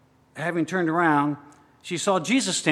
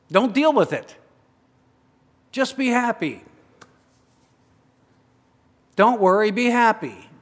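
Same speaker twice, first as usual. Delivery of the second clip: breezy dismissive world; 6 semitones higher, 5 dB louder than usual. Acted. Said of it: "the world rushes in and says".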